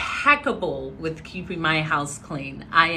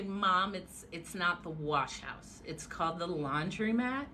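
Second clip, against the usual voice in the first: much softer, patient correcting; quiet correction; 13 dB quieter than usual. Retold or imitated. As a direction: mutter it softly to mom. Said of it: "And I would say".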